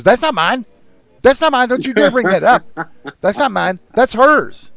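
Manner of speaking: put-on high, loud indignant old woman insisting; shrill old lady. Retelling the grocery line, the old woman denies the eggnog loudly. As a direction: mimic a cranky old biddy, loud and high. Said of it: "She goes".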